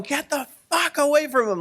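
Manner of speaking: high-pitched imitation of screaming; retold scream. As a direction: act out a frantic, angry yell without full volume. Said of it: "like, he's screaming".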